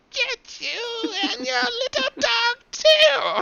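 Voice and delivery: high, slow cackling witch voice; Wicked Witch. Retold or imitated. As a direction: shriek it like a storybook witch. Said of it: "You sound like the witch out of The Wizard of Oz".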